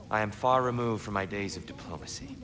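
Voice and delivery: ordinary talk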